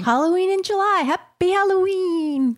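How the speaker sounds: haunt them playfully; spooky voice. Pretend. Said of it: "That was my spooky voice".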